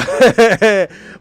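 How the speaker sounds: loud burst of laughter; real laugh bursting out loud. Real, not acted. Streamer mocking the informant idea, loud laugh.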